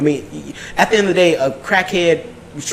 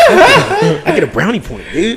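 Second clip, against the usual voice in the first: much higher, slower giggling brag; laughing mock gloat; 8 semitones higher, slower than usual. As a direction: brag through laughter about scoring a point. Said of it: "they're like".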